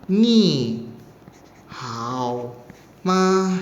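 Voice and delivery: "Ni" then "hao" dipped low then short "ma.", each split apart; syllable-by-syllable tones. Shown as a demonstration. Teacher splitting Mandarin syllables for students, separated tones. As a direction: break the greeting into single tones. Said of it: "ni-nya nada ketiga di sini ya".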